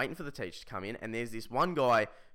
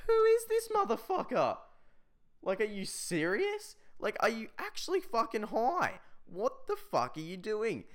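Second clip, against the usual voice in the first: high-pitched, exasperated disbelief; incredulous outrage; 9 semitones higher than usual. Acted. Student reacting to classmate taking a call in class, incredulous. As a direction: burst out high, then stretch the questions in disbelief.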